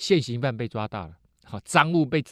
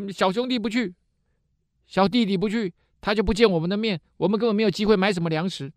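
urgent protest; 9 semitones higher than usual. Acted. Brothers protest to their father.

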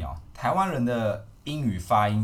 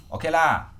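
playful Malaysian English accent, a bit higher and louder; 5 semitones higher, 4 dB louder than usual. Shown as a demonstration. Host demoing Malaysian English to a Taiwanese guest, Malaysian English accent. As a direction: show off a Malaysian English accent with sentence-final 啊. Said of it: "自己有自创的马式发音".

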